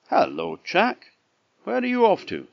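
high, loud storybook hail; cockerel's greeting. A narrator's reading. Cockerel hails Jack on the road, high loud call. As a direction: pipe up brightly as the cockerel.

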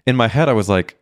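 normal talking voice

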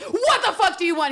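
high-pitched, exaggerated rebuff; mock angry outburst. Woman rebuffing a guy who says hey, high mock angry yell. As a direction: act out an exasperated woman's yell.

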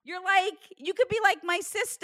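high, gushing praise; excited. Real, not acted. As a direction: pile on the supers excitedly.

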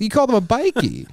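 high-pitched mock disbelief; incredulous. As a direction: query it in amused, squeaky disbelief. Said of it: "And I was like".

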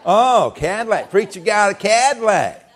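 slow, high sneer of preacher got a Cadillac; jealous churchgoer teasing; mocking church folk. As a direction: taunt it, stretching the words. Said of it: "some people would say".

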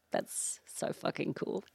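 breathy whisper, slowed; whispered awe. Retold or imitated. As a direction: hush it in disbelief. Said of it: "I was like".

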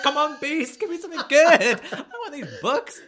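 hammed-up high begging with a laugh; begging Belle. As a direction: wheedle like a greedy bookworm. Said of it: "It's like".

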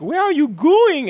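high, slow yell calling after a cat. Retold or imitated. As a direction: call out loud and stretched, like hunting for a runaway cat. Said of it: "yelling at this cat".

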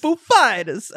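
high, loud mock Walken voice. Co-host echoes the Walken line in a high, loud mock voice. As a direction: echo the announcement, high and loud. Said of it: "you can just imagine the voice".

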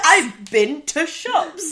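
loud, slow, exaggerated northern accent; laughing, hammed-up Yorkshire dialect; northern English accent. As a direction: drag it out in broad Yorkshire. Mimicking northern dialect together.

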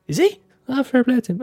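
high-pitched, mildly incredulous approval; surprised reaction. Retold or imitated. Acquaintances react to news of his podcast, high-pitched surprise. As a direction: imitate someone pleasantly taken aback, voice jumping up.

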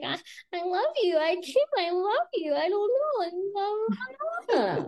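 high, breathless mock gushing; mock gushing Stassi. Retelling Stassi telling Jax she loves him, mock gushing high. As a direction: squeak it out like a lovestruck girlfriend.

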